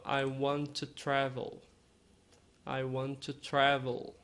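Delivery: "I want to travel" with the T of "want" joined into "to", slowly; linked T sounds. Shown as a demonstration. Teacher demonstrating connected speech to the class. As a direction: run "want to" together as one T. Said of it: "Então você fala".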